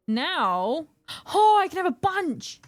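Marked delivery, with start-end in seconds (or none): 1.3-2.6 loud high delight; excited discovery. Real, not acted. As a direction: burst out thrilled. Sudden discovery.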